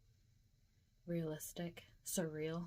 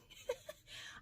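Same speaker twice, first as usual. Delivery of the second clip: breathy laugh; 8 dB quieter than usual. Real, not acted. Laughing at own story.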